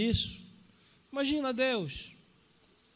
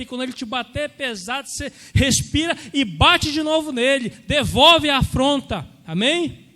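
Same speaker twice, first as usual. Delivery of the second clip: louder, driving sermon cadence; full-force preaching; 2 semitones higher, 7 dB louder than usual. Acted. Pastor urging the congregation to fight back in prayer, loud and driving. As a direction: preach it hard, rallying the church.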